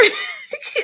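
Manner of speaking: breathless high-pitched giggle; high airy giggle. Real, not acted. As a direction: let a squeaky laugh escape. Laughing at her own quote.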